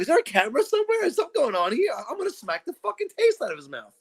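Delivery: high, fast, disbelieving rant; mock disbelief building to a threat; incredulous outrage. Retelling her reaction to the guy at the bar, incredulous. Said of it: "I'm like".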